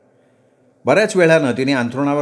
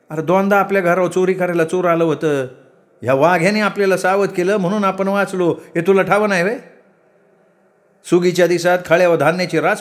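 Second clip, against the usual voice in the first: higher-pitched mother pleading a case; aggrieved village mother; 5 semitones higher than usual. Acted. Mother recounting how the dog saved them, aggrieved. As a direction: argue earnestly in a raised voice.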